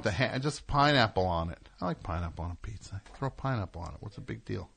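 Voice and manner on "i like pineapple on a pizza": ordinary delivery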